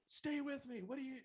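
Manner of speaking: high, loud, urgent cry; movie-style desperate plea. Retold or imitated. Father copying the movies over his son, loud high pleading. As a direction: call him back dramatically. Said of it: "I did what I saw in the movies".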